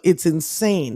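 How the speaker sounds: usual speaking voice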